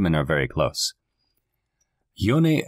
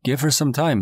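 understated raised call, lifting in pitch midway; a touch of a shout; mild shout; 7 semitones higher than usual. A narrator's reading. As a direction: call it out without really shouting. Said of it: "I shouted".

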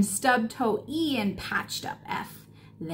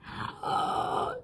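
sharp gasp; 13 semitones higher, 7 dB quieter than usual. A narrator's reading. Letters falling.

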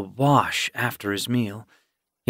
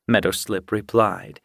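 plain reading voice

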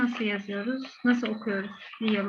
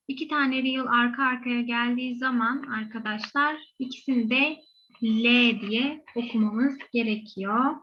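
usual speaking voice

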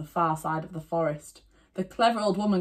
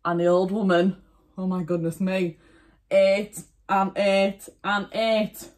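ordinary delivery

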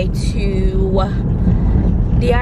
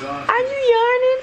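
put-on much higher, teasing the toddler's word; high playful baby talk; 13 semitones higher than usual. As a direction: echo the kid's "yarning" sky-high.

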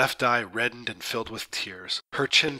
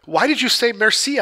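much higher, louder angry demand; furious shout; 11 semitones higher, 6 dB louder than usual. Acted. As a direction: shout it in fury at her. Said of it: "I was in a furious temper".

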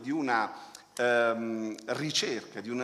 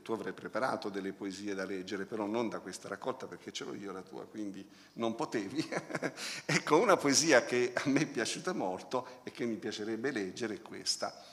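usual speaking voice

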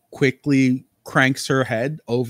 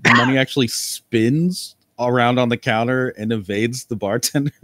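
usual speaking voice

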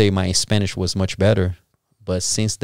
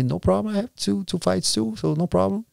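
much higher, light and unbothered; breezy confidence; 10 semitones higher, 3 dB quieter than usual. Retold or imitated. Fighter breezily telling his coach the fight is no problem. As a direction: shrug it off like it's nothing. Retells his cocky reply again.

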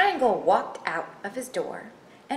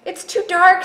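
high, loud panic; frightened Triangle; 10 semitones higher, 10 dB louder, faster than usual. Acted. Triangle finding the house dark, frightened cry. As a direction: cry out in alarm. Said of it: "Triangle was afraid of the dark".

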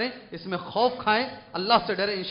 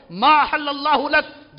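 Quran recitation; 6 semitones higher, 8 dB louder, much slower than usual. Acted. Quranic verse recited in sermon.

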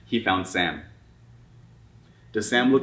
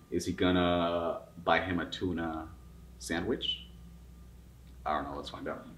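usual speaking voice

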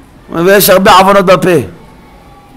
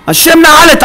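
high, raised-voice repetition; emphatic exclamation; 13 semitones higher, 4 dB louder than usual. Real, not acted. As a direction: drive the point home with a high cry. Preacher repeats claim with force. (קריאה נרגשת)

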